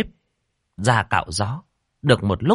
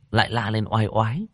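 ordinary delivery

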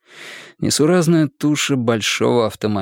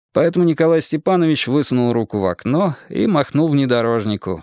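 ordinary delivery

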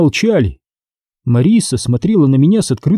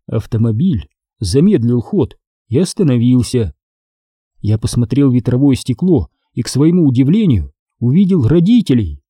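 plain reading voice

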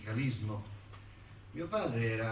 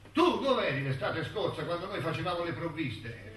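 raised, louder voice of a reproachful ant; scolding ant; 7 semitones higher, 6 dB louder than usual. Acted. Ants confront the hungry cicada over last summer's provisions, scolding. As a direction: round on the idle cicada with a higher, forceful challenge.

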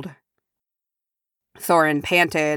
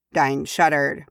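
ordinary delivery

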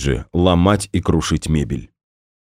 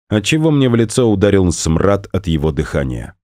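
ordinary delivery, nothing marked

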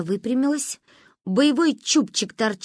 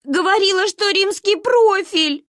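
high, loud, drawn-out bawl; bursting into tears; 11 semitones higher, 6 dB louder than usual. A narrator's reading. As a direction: wail your hurt reproach. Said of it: "разревелась я".

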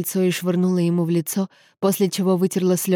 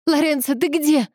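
high-pitched urgent call; mildly raised, distressed call; 9 semitones higher than usual. A narrator's reading. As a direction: cry out for someone in the next room. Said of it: "крикнула она".

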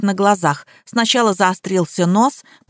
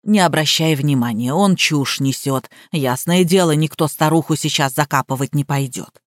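low, unhurried reassurance; perfectly calm; 6 semitones lower than usual. A narrator's reading. Housekeeper reassuring maid nobody will bury her, calm. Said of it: "совершенно спокойно продолжила".

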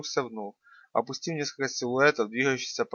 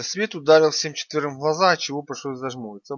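usual speaking voice

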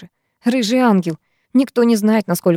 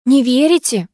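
higher, cross question; mildly angry and pitched up; 6 semitones higher than usual. A narrator's reading. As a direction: challenge them, annoyed. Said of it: "сердилась Викуля".

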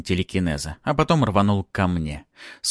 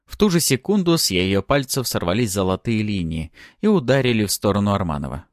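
ordinary delivery, nothing marked

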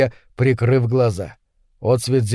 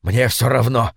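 ordinary delivery

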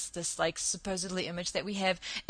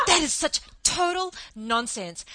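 angry shout; 6 semitones higher than usual. Retold or imitated. Angry man rejecting the claim about calves, retold shout. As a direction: shout him down in disbelief. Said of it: "And he got very angry".

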